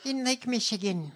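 high, whispery exaggerated politician voice; hammed-up parody of her folksy anecdote; mocking politician impression. Host parodying her constituent story, mocking impression. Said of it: "You sound insane".

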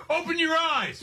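high holler at an umpire; mock heckler's yell. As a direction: imitate a fan heckling the ump. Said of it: "I'm gonna tell this umpire".

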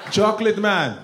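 loud, punched chocolate man; punchline. Acted. Comic shouting the punchline. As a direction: hit the punchline.

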